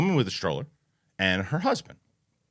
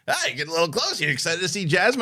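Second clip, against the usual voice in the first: higher, playful ribbing; friendly joking; 6 semitones higher than usual. Acted. Joking with a dad near Jasmine at Disney, friendly joking. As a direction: crack a light joke with a stranger. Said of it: "he'd be like".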